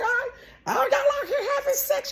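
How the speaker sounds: very high, cracking up mid-rant; giggling sermon to the young girls; laughing mock scold. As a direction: scold the room while laughing. Mock scolding the crowded clinic.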